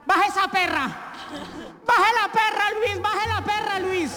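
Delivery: high-pitched screaming at her husband; wife screaming at husband to get the dog out of the car; shrill yell. Retold or imitated. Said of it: "le empieza a gritar".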